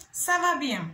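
Ça va bien with a falling end on va; model answer. Shown as a demonstration. Teacher modelling the answer Ça va bien for learners. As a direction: let Ça va bien fall. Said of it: "we want to say".

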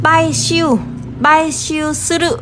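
Baishiu suru spelled out very slowly, syllable by syllable; slow model reading. Shown as a demonstration. Teacher slowly reads the new verb to students. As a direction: read Baishiu suru slowly so learners can follow. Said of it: "chữ gì đây ạ".

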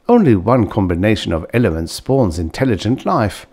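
usual speaking voice

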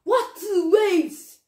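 loud, high, slow battle cry; shouted spell. Acted. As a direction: shout the attack out. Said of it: "then he shouted".

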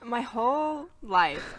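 ordinary delivery

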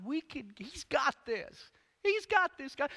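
high, loud, building cry that he's got this; exclaiming God's got this; excited reassurance. Acted. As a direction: insist with rising excitement. Joseph voiced reassuring Mary.

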